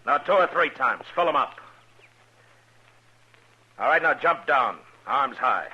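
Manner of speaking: loud, high, firm orders from a distance; commanding shout. Acted. Marshal shouting orders to the wagon driver. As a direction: call out each order clearly.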